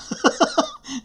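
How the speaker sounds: high, rippling chuckle; laugh. Real, not acted. Teacher joking that MIT is angry, laughing. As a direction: giggle at your own joke.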